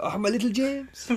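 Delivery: high-pitched, cooing mock mother; doting mum impression. Co-host imitates his friend's mum fussing over little James, high mock cooing. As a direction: coo in a put-on high mum voice. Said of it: "She's like".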